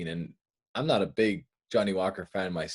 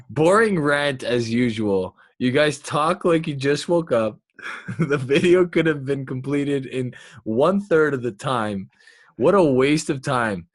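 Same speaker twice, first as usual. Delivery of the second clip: mock reading a troll comment; 4 semitones higher, 6 dB louder than usual. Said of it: "this is for both of us".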